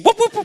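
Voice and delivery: very high, loud whooping; celebratory whoops. Real, not acted. Speaker leading applause for the organiser, high whoops. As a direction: whoop three times to get the crowd cheering. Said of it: "can we get a whoop for Nitu?".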